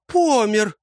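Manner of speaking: high, startled outcry; gasped shock. A narrator's reading. Woman seeing a man collapse and thinking him dead, shocked gasp. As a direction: gasp it out, pitched well up. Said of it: "ахнула Стрепея".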